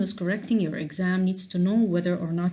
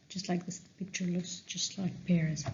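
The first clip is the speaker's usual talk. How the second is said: mumbling; 7 dB quieter than usual. Shown as a demonstration. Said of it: "he mumbled".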